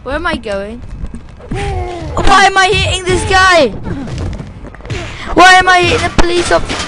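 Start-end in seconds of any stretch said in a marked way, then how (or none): 1.5-6.9 loud confused yell. Real, not acted. Confused, hitting cop in game.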